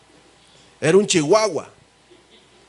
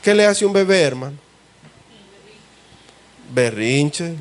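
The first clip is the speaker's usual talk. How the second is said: normal talking voice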